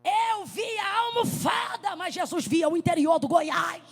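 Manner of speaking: slow, loud, drawn-out pulpit cry; preaching at full force. Acted. Preacher driving home her point, slow loud cry. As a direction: hammer each word home to the congregation.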